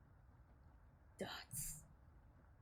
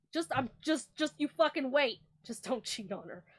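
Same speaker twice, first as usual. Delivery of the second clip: ordinary talk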